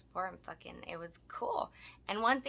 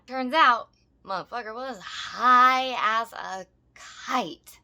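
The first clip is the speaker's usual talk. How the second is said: loud reveal; 4 semitones higher, 11 dB louder, slower than usual. Real, not acted.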